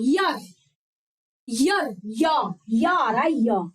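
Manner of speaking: यर and या drawn out slowly, stressing the y sound. Shown as a demonstration. Teacher breaking the Y sound into Hindi syllables for the class. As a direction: stretch out यर, यर, या, one syllable at a time. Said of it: "इसकी हिंदी देखो".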